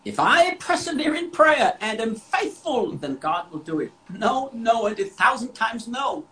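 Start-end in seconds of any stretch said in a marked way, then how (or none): none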